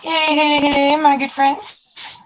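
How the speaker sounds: high, slow sing-song hello to the viewers; streamer greeting. Acted. Opening the video to his viewers, high slow sing-song greeting. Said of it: "when you going live again".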